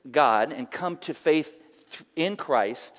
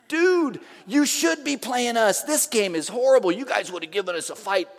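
high, loud, indignant sports fan; fired-up fan; 5 semitones higher, 4 dB louder than usual. Retold or imitated. Seahawks fan arguing back at the bar, fired up. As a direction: protest like a worked-up rival fan.

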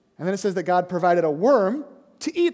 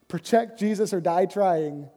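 normal talking voice

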